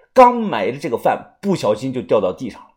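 ordinary delivery

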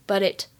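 "But it" linked with a flapped, d-like t; American voiced t. Shown as a demonstration. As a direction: say "But it" as one word, like "buddit". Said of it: "当中可以浊化". (“But it”连读，t读成浊化的轻弹音)

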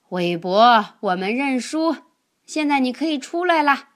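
loud call to someone far away. Acted. Elmer gives up and calls for Webb to come out, loud call. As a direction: shout so the hider hears. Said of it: "艾玛叫道".

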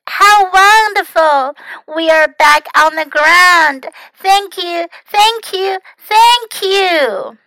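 high, elated exclamations; joyful cry. A narrator's reading. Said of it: "Froggy cried".

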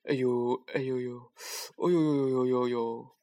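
hammed-up moaning of a pig faking pain; a pig rolling on the ground pretending to hurt, groaning theatrically; fake groaning. A narrator's reading. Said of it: "装得可像呢".

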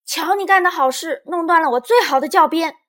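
high, loud angry outburst; shouting accusingly. A narrator's reading. Lizi blames her friend for the broken pointer, shouting. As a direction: cry out in blame. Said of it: "丽兹嚷了起来".

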